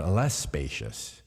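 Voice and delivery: ordinary delivery, nothing marked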